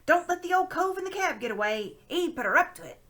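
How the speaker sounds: loud, high shout from the crowd; calling out. Acted. Crowd member shouts not to let the old man escape. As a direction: yell it over the noise. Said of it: "someone else called out".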